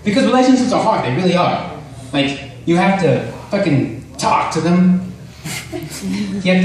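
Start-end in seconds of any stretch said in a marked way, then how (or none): none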